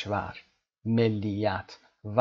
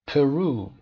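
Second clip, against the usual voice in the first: پرو with the second syllable much louder and higher; stress on RU; 4 semitones higher, 7 dB louder, much slower than usual. Shown as a demonstration. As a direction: punch the RU in پرو. (تأکید روی بخش رو در پرو)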